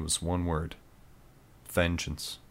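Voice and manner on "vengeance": plain reading voice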